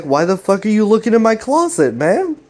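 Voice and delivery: high-pitched acted indignation; indignant retort. Greg answering his brother about the closet, mock indignation.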